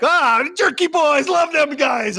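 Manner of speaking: high, drawn-out boisterous pizza man; hammed-up, stretched-out delight. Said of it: "you sound like [name] from a Jerky Boys call".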